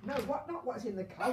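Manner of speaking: ordinary talk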